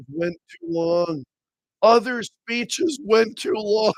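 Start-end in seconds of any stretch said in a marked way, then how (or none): 1.8-3.9 stretched out slowly in mock disbelief; incredulous repeat. Host repeats the party's excuse to ridicule it, drawn-out disbelief.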